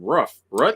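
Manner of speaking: "Roof" and "Roots" with short vowels; puzzled Seattle pronunciation. Retold or imitated. Puzzling over Seattle news, "Roof" and "Roots" with short vowels. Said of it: "watching the Seattle news and going".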